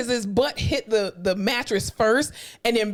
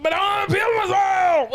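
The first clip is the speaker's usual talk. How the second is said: whiny shout; 9 semitones higher than usual. Retold or imitated. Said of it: "he was like".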